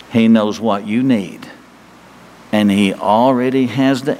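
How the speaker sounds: low, slow and earnest sermon close; slow, low preaching. Acted. Preacher urging the congregation, slow and low. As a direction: bring it down low and let each phrase land.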